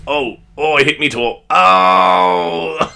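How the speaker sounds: yelping after stubbing a toe; real pain. Real, not acted. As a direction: groan through a sudden sharp hurt. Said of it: "Oh, pain".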